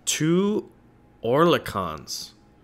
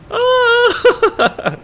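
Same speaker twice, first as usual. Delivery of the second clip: high, loud laughing shriek; laughing cry; 10 dB louder than usual. Real, not acted. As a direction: burst out in a high, loud cackle. Loud laughing outburst at game.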